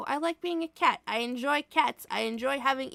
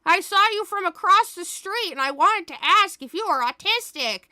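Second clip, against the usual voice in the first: loud, high fake-cheery stranger; asking a stranger, hammed up loud; mock stranger; 8 semitones higher, 8 dB louder than usual. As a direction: overeager stranger barging up. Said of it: "be like".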